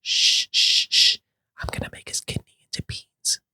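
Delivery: creepy hushed whisper. Acted. Doctor hushing the sister to sleep, in a creepy whisper. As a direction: whisper it like a lullaby gone wrong.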